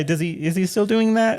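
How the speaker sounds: high, sweet therapist voice. Pretend. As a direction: gently coax a young patient. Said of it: "act out a possible therapy session".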